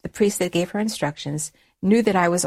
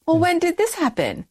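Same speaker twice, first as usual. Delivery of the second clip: imitating a startled mother, much higher; high-pitched, incredulous retold question; astonished questioning; 10 semitones higher than usual. Daughter questioning her mom about converting, astonished, high-pitched.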